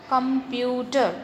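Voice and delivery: कंप्यूटर broken into three parts, "com-pyu-ter"; teacher dividing कंप्यूटर into three syllables; split into syllables. Shown as a demonstration. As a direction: sound out कंप्यूटर part by part. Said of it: "कंप्यूटर three part में divide हो रहा है".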